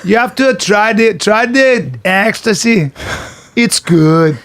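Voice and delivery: lightly done mock Brazilian accent, slow and coaxing; a hint of a Brazilian dealer selling ecstasy. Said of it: "I'm trying to do my Brazilian accent".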